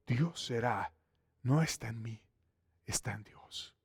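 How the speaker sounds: soft, dropping to a near whisper; humble answer to Pharaoh, hushed and soft. Acted. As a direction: let it fall away reverently.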